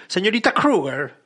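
put-on a slow, lightly done English accent; mock English accent. Host tries an English accent for co-hosts, mock English accent. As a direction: try out a posh English accent on "Señorita Kruger". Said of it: "¿Cómo sería un acento inglés?".

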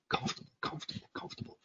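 quick whispered chant; rhythmic repetition. Shown as a demonstration. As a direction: whisper it fast to feel the rhythm. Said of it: "you feel the rhythm".

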